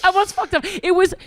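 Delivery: high burst of laughter; laughing. Real, not acted. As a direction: laugh through the words. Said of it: "laughing at the story".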